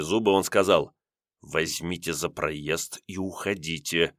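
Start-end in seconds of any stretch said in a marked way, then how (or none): 1.4-4.1 тихо, медленно, низким шёпотом; прошипеть угрожающе, почти беззвучно; зловещий шёпот. A narrator's reading. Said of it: "Перейдя на зловещий шёпот". (Леонтий зловещим шёпотом гонит кондукторшу)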